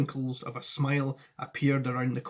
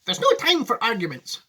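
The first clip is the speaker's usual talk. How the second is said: loud, high Wonka exclaiming; brisk cry; 9 semitones higher, 7 dB louder than usual. Acted. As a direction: call it out with urgency. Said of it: "cried Mister Wonka".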